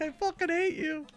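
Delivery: put-on high-pitched voice of resentment; mock exasperated coworker. Young teacher to smug retiring colleague, high mock outrage.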